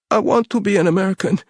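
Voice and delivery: high, tearful and pleading; a terrified captive crying for mercy; sobbing plea. A narrator's reading. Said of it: "he sobbed".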